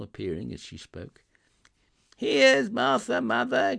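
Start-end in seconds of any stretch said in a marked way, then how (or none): 2.2-3.8 put-on excited children crying out. A narrator's reading. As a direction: cry it out like two eager kids. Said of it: "cried the two young Cratchits".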